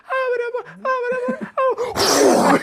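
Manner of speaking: very high, garbled mock hollering; imitating shouts nobody can make out; inaudible yelling. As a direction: squeal it as an unintelligible far-off yell. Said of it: "couldn't tell what we were saying".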